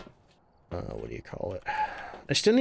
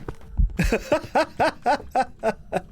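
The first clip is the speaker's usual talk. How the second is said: high burst of laughter; disbelieving laugh; 11 semitones higher than usual. Real, not acted. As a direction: laugh at the absurdity. Laughing after outburst.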